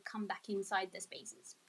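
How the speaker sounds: ordinary talk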